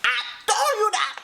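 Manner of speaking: high, laughing I-told-you-so; gleeful told-you-so. Real, not acted. To the storyteller whose prediction came true, high laughing gloat.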